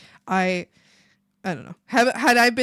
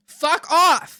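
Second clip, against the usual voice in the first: louder, higher burst of annoyance; pissed off; 4 semitones higher, 8 dB louder than usual. Real, not acted. Telling off whoever snooped in storage, pissed-off snap. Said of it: "They pissed me off so much".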